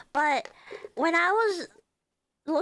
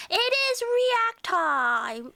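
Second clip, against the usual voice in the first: high, slow hype call; excited announcer; 8 semitones higher, much slower than usual. Acted. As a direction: stretch it out like a show opener. Announcing react segment.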